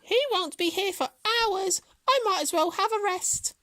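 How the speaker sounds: very high hare voice; squeaky, self-satisfied musing; smug hare. A narrator's reading. Hare decides to rest before the finish, high and smug. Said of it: "he laughed to himself".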